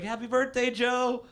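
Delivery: high, sing-song birthday wish; chirpy fake well-wisher; high bright mock birthday greeting; mock cheery greeting. Mock greeting card quote.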